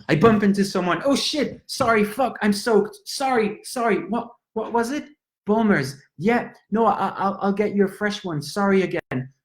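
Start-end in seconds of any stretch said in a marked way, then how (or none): 1.0-9.3 higher, rushed, panicky sorries; flustered apologizing. Acted. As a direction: babble apologies after spilling a drink. Bumped into someone, spilled drink.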